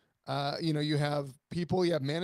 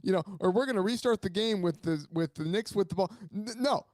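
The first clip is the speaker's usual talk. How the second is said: ordinary talk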